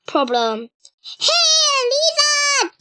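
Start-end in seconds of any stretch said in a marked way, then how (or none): 1.2-2.7 higher, carrying call to a lost pet. A narrator's reading. Boy calling for his lost lizard, raised calling voice. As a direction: call across the yard for the lizard. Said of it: "he called out".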